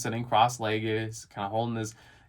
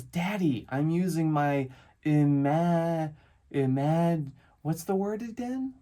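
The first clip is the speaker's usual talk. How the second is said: put-on higher, slow, halting child voice; serious little boy; 4 semitones higher, slower than usual. Dad mimics his serious son forgetting a word, halting child voice. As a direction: imitate a kid groping for a long word. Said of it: "he's so serious about this".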